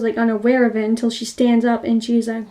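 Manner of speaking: ordinary talk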